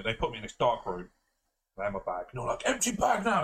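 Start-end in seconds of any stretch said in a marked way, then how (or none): 2.6-3.4 high, sharp imitation of an official's command; mock security-guard order, high and urgent; retold angry order. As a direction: have a go at them, pitched way up. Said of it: "They started really having a go at me".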